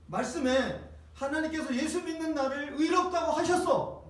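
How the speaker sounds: high-pitched, emphatic rebuttal; defiant declaration. Acted. Believer answering the accusing devil, defiant declaration. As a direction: stand firm and throw the accusation back. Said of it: "이러면 여러분 딱 그래".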